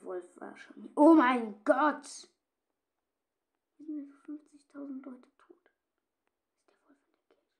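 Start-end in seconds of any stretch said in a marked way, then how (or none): 0.9-2.3 loud, slow, high cry of shock; shocked shout. Real, not acted. Player finding the bodies, loud shocked cry. 3.8-7.5 voiceless hushed whisper; scared whisper. Real, not acted. Player afraid the werewolf is near, scared whisper. Scared after finding bodies.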